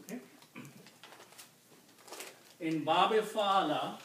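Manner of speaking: ordinary talk, nothing marked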